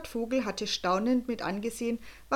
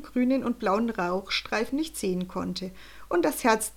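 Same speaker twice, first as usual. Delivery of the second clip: ordinary delivery, nothing marked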